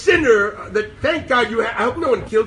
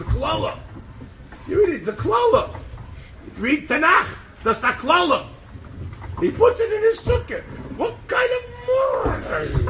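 high-pitched, incredulous scolding; mock outrage; 10 semitones higher than usual. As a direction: climb higher and higher into an exasperated squawk. Acted outraged objection to sukkah sign.

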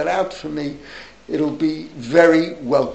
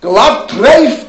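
glatt treif shouted out much louder; furious rabbi's cry, retold; retold yell; 6 semitones higher, 9 dB louder, slower than usual. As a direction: bark the rabbi's outrage, partly acted. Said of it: "the Rav yelled at him".

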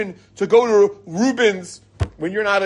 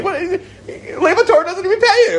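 laughing; 13 semitones higher, 3 dB louder than usual. Real, not acted. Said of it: "laughs through own joke".

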